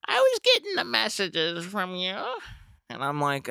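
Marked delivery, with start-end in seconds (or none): 0.1-2.2 put-on high old lady voice; elderly neighbor. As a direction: repeat the neighbor's line in her high voice.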